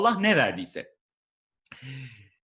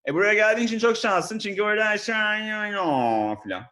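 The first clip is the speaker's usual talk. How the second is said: higher, louder gushing corporate babble; babbling manager gibberish; 6 semitones higher, 5 dB louder than usual. Retold or imitated. Comedian imitates a new manager's welcome babble. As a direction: gush empty office buzzwords. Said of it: "yöneticim konuşurken böyle oluyor".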